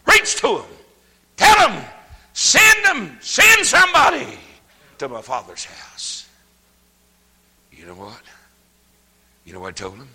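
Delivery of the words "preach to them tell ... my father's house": very high, loud preacher's shout; preacher roaring at the church to send word; shouted sermon commands. Acted. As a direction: hurl each order at the congregation.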